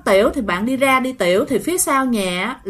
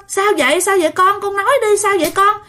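higher, urgent pleading; anxious pleading; 7 semitones higher than usual. Retold or imitated. Family begging the crying boy to speak, anxious and high. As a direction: beg the scared boy to answer.

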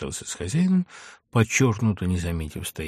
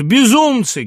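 loud, high exclamation; outraged yell at madness; appalled shout; 11 dB louder, slower than usual. A narrator's reading. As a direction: cry out in exasperation. Friend cries out at the duellists.

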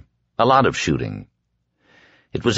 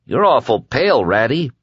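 higher, teasing grin; grinning taunt; 5 semitones higher than usual. A narrator's reading. Prisoner taunts the frightened guard, grinning. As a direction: tease him through a grin.

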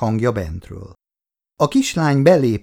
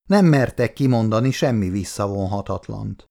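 plain reading voice

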